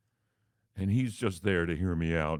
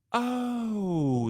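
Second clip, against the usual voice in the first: much higher, bright dawning realization; a receptionist cheerfully transferring the odd call; high sugary Southern receptionist; sweet receptionist; 8 semitones higher than usual. Retold or imitated. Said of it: "the sweet Southern Miss Congeniality voice".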